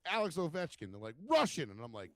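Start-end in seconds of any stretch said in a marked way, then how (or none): 0.1-0.9 higher, punchy quoted answer; re-enacted comeback, pitched up; retold comeback. His retold comeback to the challenge. 1.3-1.7 high, loud mock shout; imitated outburst, pitched way up; exasperated correction. Hecklers' retold exasperated correction.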